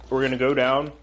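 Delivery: normal talking voice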